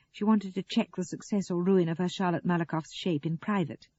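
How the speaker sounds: ordinary delivery, nothing marked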